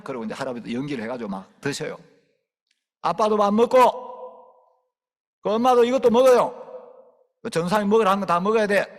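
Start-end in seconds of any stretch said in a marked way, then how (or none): 3.0-8.9 much louder, higher, fast commands; bossy orders. Pretend. Preacher ordering parents to eat for the child's sake, loud. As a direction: boss everyone around.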